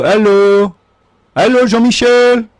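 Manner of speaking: put-on French-accented dad, high and loud; French dad impression. Son imitating his French dad's greeting, accented. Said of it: "That's my dad".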